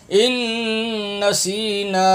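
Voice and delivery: ان نسینا chanted slowly, nun and final naa held; drawn-out madd. Shown as a demonstration. Teacher shows the madd, drawn-out ان نسینا. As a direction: stretch the vowels of نسینا. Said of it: "اس کو کھینچ کے پڑھیں گے".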